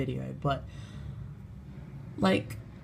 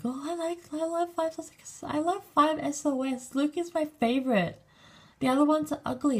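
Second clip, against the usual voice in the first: squeaky high-pitched gushing fan voice; mock preteen fangirl; 12 semitones higher than usual. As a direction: mimic an obsessed little fangirl, way up high. Mocking twelve-year-old fans at school.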